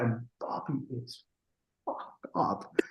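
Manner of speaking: hushed, unvoiced aside; confidential whisper. Real, not acted. Telling the host about the husband's state, whispered. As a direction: drop to a whisper as if it's gossip.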